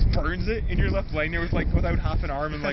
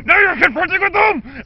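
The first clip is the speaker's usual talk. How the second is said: high, loud mock rage; furious shout; 11 semitones higher, 11 dB louder than usual. Dad in the story screaming at the neighbor in a loud mock rage. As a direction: scream the accusation at full volume.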